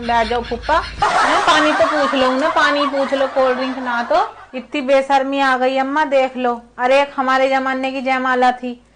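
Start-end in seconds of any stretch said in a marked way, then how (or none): 0.1-4.0 lightly voiced dismissive hosts; a hint of offhand hospitality; mimicking the hosts. Aunt mimics hosts seating the uncle, lightly mocking. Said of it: "उन्हें बैठे में बिठा दिया".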